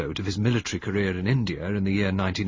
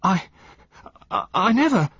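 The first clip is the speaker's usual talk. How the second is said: panicked stammer; 11 semitones higher, 6 dB louder than usual. A narrator's reading. Accused man stammers denial.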